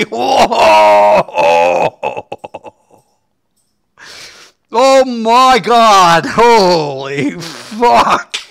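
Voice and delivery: loud cry, then slow drawn-out oh my god; stunned exclamation. Real, not acted. Gamer stunned by the arena bow news, slow astonished cry. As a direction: gasp it out, dragging every word.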